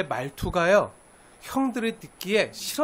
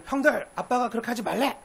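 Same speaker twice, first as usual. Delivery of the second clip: tattling little brother; 4 semitones higher than usual. Retold or imitated. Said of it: "그러면서".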